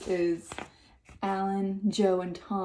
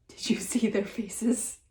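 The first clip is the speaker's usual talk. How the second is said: laughing. A narrator's reading. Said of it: "Billy said, laughing".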